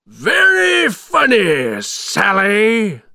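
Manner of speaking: high, loud, drawn-out taunt; mocking villain. A narrator's reading. Villain to defiant captive Sally, mocking.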